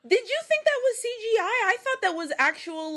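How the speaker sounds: incredulous. Real, not acted. Disbelief at cohost's claim.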